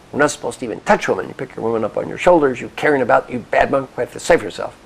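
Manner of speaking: loud, mock-indignant telling-off; angry scolding. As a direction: scold like an outraged monk. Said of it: "is angry".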